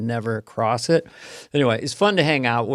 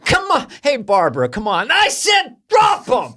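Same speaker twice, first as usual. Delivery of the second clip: much higher, louder hammed-up demand; mock demanding shout; 11 semitones higher, 5 dB louder than usual. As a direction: shout it like a pushy brute. Acting aggressive guy.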